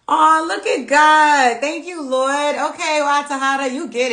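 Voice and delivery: high, loud, slow delighted exclamations; overjoyed praise. Real, not acted. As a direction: marvel at it like a small miracle. Reacting to a spot-on comment.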